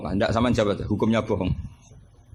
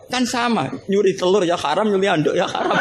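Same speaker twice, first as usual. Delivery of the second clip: higher-pitched, insistent comeback; stubborn mock protest, pitched up; refusing to lose; 8 semitones higher than usual. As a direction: argue back like a teacher who won't admit defeat. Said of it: "karena nggak mau kalah".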